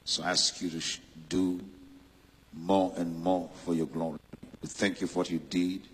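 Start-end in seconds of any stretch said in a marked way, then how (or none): none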